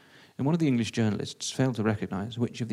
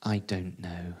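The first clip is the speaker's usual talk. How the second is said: low, soft confession; sheepish admission; 6 semitones lower, 5 dB quieter, much slower than usual. Acted. Bluffer confesses to press officer, low and sheepish. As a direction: drop the laughter, admit it low and quiet.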